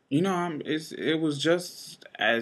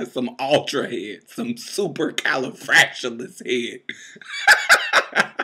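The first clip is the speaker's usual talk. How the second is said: laughing while joking; 5 dB louder than usual. Real, not acted.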